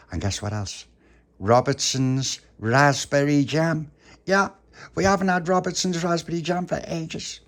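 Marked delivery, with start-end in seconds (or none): 1.4-7.4 high, loud, relishing each word; thrilled, pitched-up boast; excited reveal. Acted. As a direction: announce the treat with delight. Tony reveals special jam.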